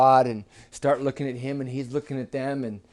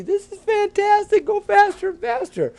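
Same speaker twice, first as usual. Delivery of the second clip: very high, loud frog squeal; thrilled riders shrieking with delight; wildly excited; 7 dB louder than usual. A narrator's reading. Bullfrogs going crazy on the ride, wildly excited. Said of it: "Bullfrogs were going crazy".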